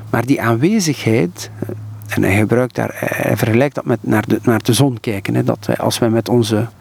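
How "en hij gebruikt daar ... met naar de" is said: ordinary talk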